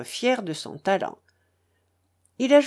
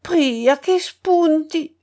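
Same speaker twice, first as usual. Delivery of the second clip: high, loud, slow operatic flourish; imitating a tenor's aria; 7 semitones higher, 5 dB louder, slower than usual. A narrator's reading. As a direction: draw the words out grandly like a famous tenor. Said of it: "quand il chantait l'air célèbre".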